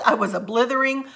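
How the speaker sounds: ordinary delivery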